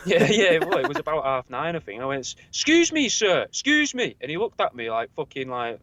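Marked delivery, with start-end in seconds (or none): 2.5-4.2 high, slow, drawn-out polite calling. Retold or imitated. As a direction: call out to a stranger, overly polite. Calling out to an old man.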